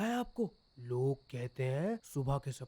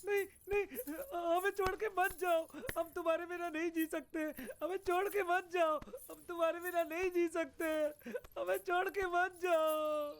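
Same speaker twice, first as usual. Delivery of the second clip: desperate begging; 14 semitones higher than usual. Acted. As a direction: beg not to be left behind. Man begs in nightmare.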